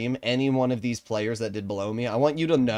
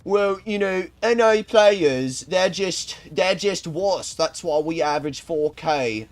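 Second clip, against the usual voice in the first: higher, mocking British accent; 4 semitones higher, slower than usual. Streamer mimics an EU player putting down NA, mock British accent. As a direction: put on a stretched-out British accent. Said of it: "Specifically use the British accent".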